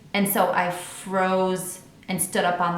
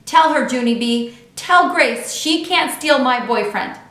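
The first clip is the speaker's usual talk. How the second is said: demanding; 5 semitones higher, 8 dB louder than usual. A narrator's reading. Lucille demands Junie B back her.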